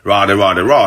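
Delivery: ordinary talk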